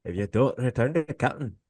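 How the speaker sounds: put-on high Scottish accent, casual threat. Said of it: "your Scottish accent".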